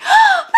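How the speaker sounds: sharp high gasp of inspiration; excited gasp. Acted. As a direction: gasp like a bright idea hit. Excited gasp before voicing writers.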